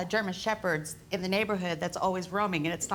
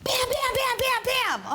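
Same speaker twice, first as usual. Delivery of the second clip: high, loud, rapid-fire bams; imitated door banging; 5 dB louder than usual. Defendant acts out late-night pounding at her door, loud and high. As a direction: pound out the knocking with your voice. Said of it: "banging at my door".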